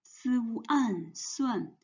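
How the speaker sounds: Si, wu, an, then suan blended together; sounding out pinyin. Shown as a demonstration. Teacher spelling suan for students, slow and clear. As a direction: spell out the parts of suan, then join them. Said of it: "and one compound final an".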